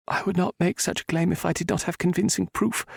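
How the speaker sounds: calm, quiet reply in a lighter voice; quietly, as the woman. A narrator's reading. Heroine quietly answering the Viscount's accusation. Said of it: "she said quietly".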